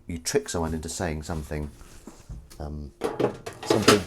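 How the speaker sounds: ordinary delivery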